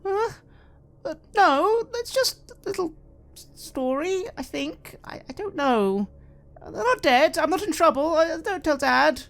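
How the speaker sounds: put-on panicked girl begging not to tell her father; scared little girl. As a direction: plead like a kid afraid of getting in trouble. Girl panicking about her drawings.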